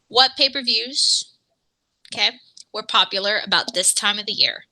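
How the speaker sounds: ordinary talk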